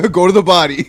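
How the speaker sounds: loud, high coaching shout; playful corner shout to a boxer; mock cornerman. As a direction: yell advice from ringside, joking.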